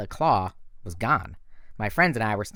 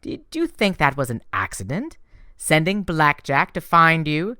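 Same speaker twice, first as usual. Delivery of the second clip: higher, sweetly teasing goddess voice; Aphrodite, sly and gushing; 7 semitones higher, 5 dB louder than usual. A narrator's reading. Goddess teasing Percy about her secret help, higher and sweet. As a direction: purr it like a doting goddess. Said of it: "she said".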